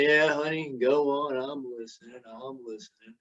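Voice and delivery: a low, fading, half-listening mumble; distracted husband. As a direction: trail off, eyes glued to the TV. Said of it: "He said".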